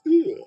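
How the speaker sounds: high, loud imitated grunt; friend's disgusted ugh. Explaining what was so funny, imitated disgust noise. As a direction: squeal out his disgusted "ugh". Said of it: "It's the way he did it".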